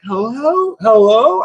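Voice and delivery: high-pitched calling hello, not truly loud; mock call into someone's house; retold loud call. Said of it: "I'm saying it really loud".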